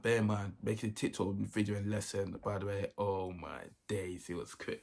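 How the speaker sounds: ordinary talk, nothing marked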